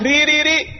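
"ري ري ري" with a thin R before i; light ra. Shown as a demonstration. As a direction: repeat "ري" with a light, unemphatic R. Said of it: "متى ترقق؟".

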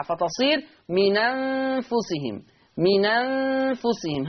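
من أنفسهم read slowly with the nun voiced on the hamza's vowel; نقل حركة الهمزة إلى النون; naql demonstrated. Shown as a demonstration. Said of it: "هكذا".

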